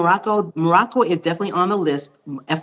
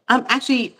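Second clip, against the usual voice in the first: ordinary talk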